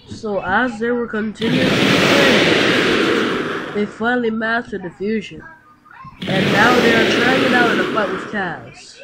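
slow, measured narrator voice; story narration. A narrator's reading. Narrator setting up the fight, slow and measured. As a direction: read it like the narrator of the tale. Narration between acted scenes.

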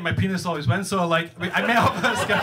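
ordinary talk

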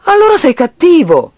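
high, loud reproach; angry accusation. Acted. Child accuses piggy bank of meanness, angry.

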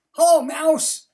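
very high, loud cheery duck greeting. A narrator's reading. Duck greeting mouse, very high and loud. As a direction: shout hello brightly as Duck. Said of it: "said Duck".

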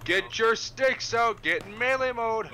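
high, loud, slow command; shouting orders over battle. Acted. Officer orders melee.

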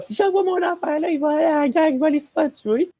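squeaky, fretting imitation of an alarmed parent; worried parent. Cadet mocking parents who fear the army cadets, high-pitched mock panic. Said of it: "les parents sont comme".

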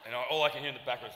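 ordinary delivery

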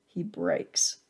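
ordinary delivery, nothing marked

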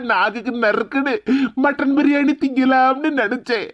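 comic wailing lament; crying. Acted. Man to friend over lost biryani, hammed-up crying. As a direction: sob and wail through every complaint. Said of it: "அழாதீங்கண்ணே".

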